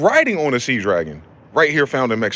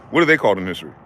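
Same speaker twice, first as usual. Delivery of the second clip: showing a pitch drop; 7 semitones lower, much faster than usual. Shown as a demonstration. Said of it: "a pitch drop if you don't even know".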